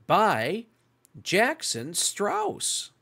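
bright, lively storytime read; picture-book reading voice for young listeners; reading to children. Acted. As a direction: read it like storytime for little ones. Reads picture book to kids.

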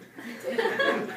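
a sudden high, loud, breathy laugh. Real, not acted. A laugh as she finishes the hospital story. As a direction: burst out laughing, airy and high.

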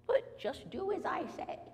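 lightly acted high, slow order; insistent old woman. Old woman orders man to obey, lightly acted insistence.

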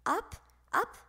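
high and spaced out; rising with the ball. A narrator's reading. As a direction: lift each word like a climbing ball. Ball sailing higher and higher.

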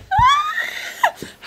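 very high, sustained squeal of panic; high-pitched freak-out scream; panicked shriek. Real, not acted. Gamer screaming as the mouse stays stuck, shrieking. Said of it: "I'm going to start screaming".

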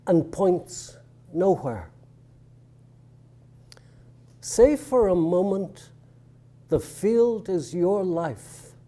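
measured poem recital with deliberate pauses; poetry reading. Real, not acted. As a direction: read it line by line, letting each phrase stand. Recites his poem to an audience.

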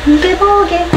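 bright sing-song tease to a pet; high teasing voice. Real, not acted. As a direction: pitch it up playfully. Teasing dog with toy.

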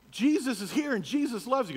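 high, loud earnest declaration; Scott repeating. Retold or imitated. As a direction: repeat it loudly to the thug. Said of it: "He goes".

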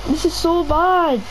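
ordinary delivery

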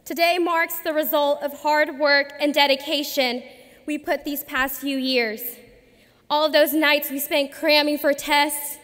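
normal talking voice

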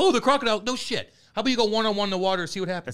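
high, loud scoffing comeback; indignant and high-pitched; exasperated retort. Real, not acted. Arguing back about the fight.

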